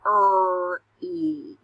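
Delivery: Er-e split into separate sounds; sounding out words. Shown as a demonstration. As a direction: sound out each piece. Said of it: "Listen to these".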